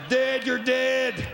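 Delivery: long blaring truck-horn words; semi honking. Retold or imitated. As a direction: stretch it out like a horn blast. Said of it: "more accurately be like".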